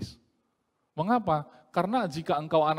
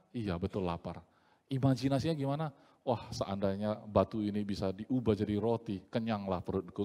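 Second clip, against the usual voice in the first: soft, lower, drained inner voice; like a man faint from forty days' fasting; weak hungry musing; 7 semitones lower, 6 dB quieter than usual. Retold or imitated. Preacher voicing a starving man's thoughts, softly and wearily.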